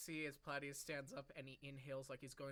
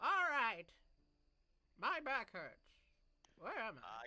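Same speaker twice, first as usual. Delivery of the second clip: put-on confused old person; 6 semitones higher, 6 dB louder, slower than usual. GM plays a dazed waking figure.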